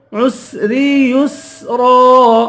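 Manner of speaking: عسری یسرا slowly with clean hissed س; clear seen. Shown as a demonstration. Teacher showing the letter seen, یسرا with a clear س. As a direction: draw out عسری یسرا, each س distinct. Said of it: "سین کو صاف کر کے پڑھیں گے".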